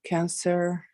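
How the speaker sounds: ordinary delivery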